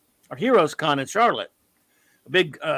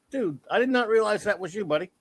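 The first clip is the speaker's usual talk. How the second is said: ordinary delivery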